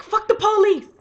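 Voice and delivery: high, loud parting shout; defiant yell. Retold or imitated. Aquaman leaps away from the cops, defiant loud shout. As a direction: yell it as you leap away. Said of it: "Then he leaps away like".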